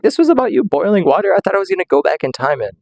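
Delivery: high, fast outraged protest; mock outrage at the letdown; indignant disbelief. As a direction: play cheated and stunned. Letdown over the witch story.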